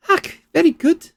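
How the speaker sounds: "Ach, very good" in a high Scottish lilt, rolled "very"; a quick Scottish burr on "Ach, very good"; Scottish accent. Acted. Researcher praising the argument, in a Scottish accent. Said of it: "she's gone Scottish now".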